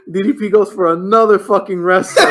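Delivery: high-pitched, laughing disbelief; cracking up. Real, not acted. Recapping yet another rest hold, cracking up. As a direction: barely get it out before bursting into laughter.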